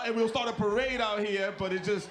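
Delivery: ordinary talk, nothing marked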